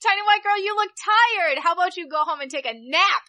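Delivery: high-pitched, lightly cooing guard; lightly voiced coddling, starting high and easing down; imitated indulgent guard. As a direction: mimic an official fussing over a tired traveler. Voicing guards kindly sending her home.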